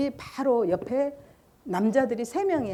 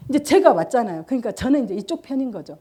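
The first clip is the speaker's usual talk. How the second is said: ordinary talk, nothing marked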